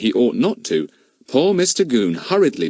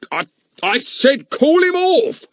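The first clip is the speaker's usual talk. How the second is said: insistent policeman; 4 dB louder than usual. Acted. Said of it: "repeated Mister Goon".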